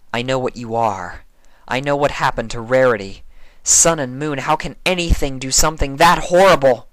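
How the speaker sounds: horrified accusation; Twilight, accusing. A narrator's reading.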